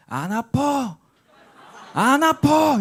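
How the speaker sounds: put-on high, loud child yelling it doesn't hurt; defiant child shout. Father mimicking his son yelling defiantly at each smack. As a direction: shout back like a stubborn little boy who won't give in. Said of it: "소리를 지르면서".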